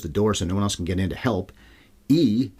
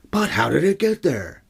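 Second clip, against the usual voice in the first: mock wonder; 9 semitones higher, 3 dB louder, slower than usual.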